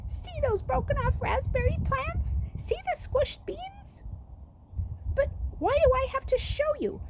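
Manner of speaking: put-on a boy whose voice cracks into a squeak; squeaky voice. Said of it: "His voice came out in a squeak".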